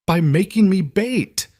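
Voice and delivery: high, slow, outraged cry; furious accusation. A narrator's reading. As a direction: hurl the accusation at him. Lena furious at Lucian.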